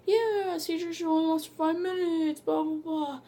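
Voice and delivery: high dismissive doctor impression; mocking doctors. Mocking the doctors who doubt her seizures, high and dismissive. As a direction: brush it off like a know-it-all neurologist. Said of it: "they, they go like".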